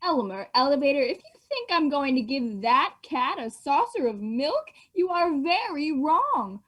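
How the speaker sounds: stern mother. Acted. Mother refusing to feed the stray cat, slow and scolding. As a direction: drag out each word like a cross parent refusing. Child plays the angry mother.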